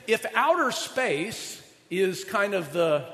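usual speaking voice

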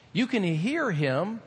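normal talking voice

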